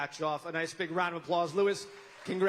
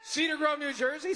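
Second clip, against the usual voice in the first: high, loud hometown shout-out; excited echo; 10 semitones higher, 3 dB louder than usual. Real, not acted. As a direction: call it out to the crowd. Echoing hometown to crowd.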